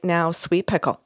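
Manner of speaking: a hint of a firm but kind father; father's gentle admonishing. Medium relaying a father's words to his daughter, low and soft. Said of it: "it's the way he says it".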